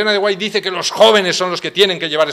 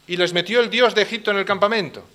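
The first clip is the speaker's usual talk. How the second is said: usual speaking voice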